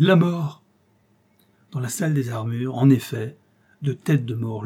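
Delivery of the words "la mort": loud repeated cries of terror; terrified cry. Acted. General cowers in the corner, terrified cry. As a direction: keep crying out in fear. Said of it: "répétait-il".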